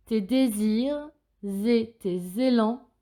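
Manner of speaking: drawn-out dictation, clear Z before "et" and "élans"; marked liaisons. Shown as a demonstration. Teacher repeats for pupils, sounding the Z links in "désirs et", "tes élans". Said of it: "Écoutez bien les liaisons ici".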